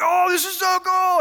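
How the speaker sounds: put-on very high, loud childish glee; a boy amazed by his toy; kid thrilled. Five-year-old self quoted.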